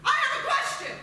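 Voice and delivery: high, loud laughing shout; eager shout. Acted. Questioner shouting over the crowd at the press conference, loud and eager. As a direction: yell to be picked.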